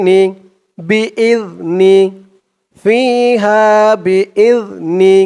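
slow melodic tilawat chant; Quran recitation. Shown as a demonstration. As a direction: draw out each word in recitation tune. (ধীর সুরেলা কুরআন তিলাওয়াত)

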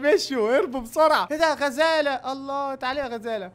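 ordinary talk

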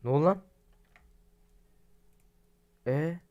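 sudden loud what happened; jolted by the glitch; surprised outburst; startled. Real, not acted.

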